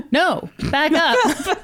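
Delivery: drawn out, ironic repeat; slow deadpan echo. Real, not acted. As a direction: stretch it out, dry and ironic.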